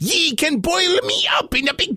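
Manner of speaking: high, insistent pirate plea; pleading pirate. Acted. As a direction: beg the giant while coaxing him.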